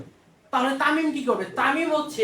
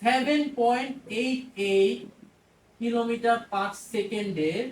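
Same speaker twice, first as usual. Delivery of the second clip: spelled out slowly; much slower than usual. Shown as a demonstration. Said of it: "teacher stressing the number".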